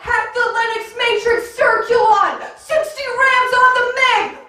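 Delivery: over-the-top hacker yelling gibberish; frantic movie hacker. Acted. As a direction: bark fake tech terms like an action hero. Hacker shouting nonsense jargon.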